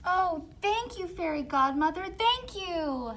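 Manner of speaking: high, excited thanks; grateful girl voice. Acted. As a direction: gush with gratitude. Cinderella thanking.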